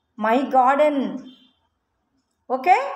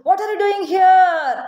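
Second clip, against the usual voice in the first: higher, louder, slow giant shout; 6 semitones higher, 4 dB louder, much slower than usual. Acted. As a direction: roar at the children as the giant. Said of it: "అని గట్టిగా అరిచింది". (కోపంతో గట్టిగా అరిచే రాక్షసుడు)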